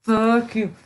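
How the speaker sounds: a casual repeat of "Fuck you"; milder retold retort. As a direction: toss it off half as hard.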